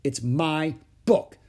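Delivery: put-on loud, slow, dug-in child; stubborn. A narrator's reading. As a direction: stubbornly hold your ground, stretching each word. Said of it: "said Ramona stubbornly".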